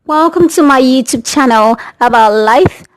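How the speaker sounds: big youtube intro shout-out; intro greeting. Acted. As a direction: announce the channel with energy.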